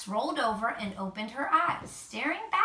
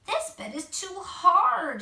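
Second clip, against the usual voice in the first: higher, louder, slow grumbling complaint; 7 semitones higher, 6 dB louder, slower than usual. A narrator's reading. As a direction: grumble about the hard bed. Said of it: "she grumbled".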